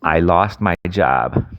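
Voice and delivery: slow, clear words for voice typing; slow, careful dictation to the app. Shown as a demonstration. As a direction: spell it out slowly for the phone to hear. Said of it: "use the voice activation system here".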